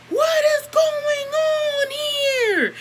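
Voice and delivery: imitating Bougie's slow, high, outraged shout; imitating an adult's shout. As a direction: shriek it like a startled adult walking in. Bougie catching the girls fighting.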